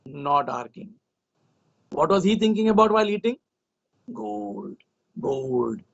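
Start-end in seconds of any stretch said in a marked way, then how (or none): 4.1-5.8 low breathy murmur of gold. Acted. King brooding at dinner, low and soft. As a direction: drop low, lingering over gold.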